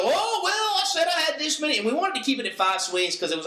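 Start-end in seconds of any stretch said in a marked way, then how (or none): 0.0-1.8 whiny mock excuse, pitched way up; imitating a complainer. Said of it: "people to go".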